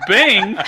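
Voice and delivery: "bing" held long, high and loud; full car chime. Shown as a demonstration. Driver showing how a full tank should sound, drawn-out chime. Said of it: "It's supposed to go".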